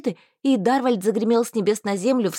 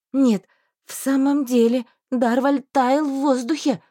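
ordinary delivery, nothing marked

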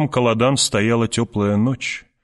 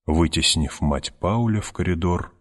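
usual speaking voice

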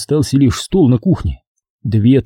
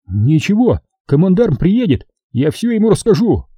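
high, spiteful threat; threatening officer; 7 semitones higher than usual. Acted. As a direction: promise to snitch to the general. Seryoga threatens.

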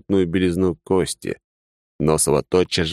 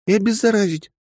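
put-on high, insistent young woman's voice. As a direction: press the point as a stubborn young woman. The lieutenant adds.

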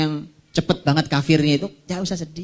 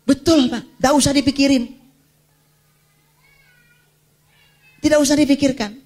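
urgent exhortation; 11 semitones higher, 3 dB louder than usual. Real, not acted. Preacher urges, high pitched.

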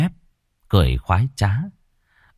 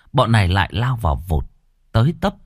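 ordinary delivery, nothing marked

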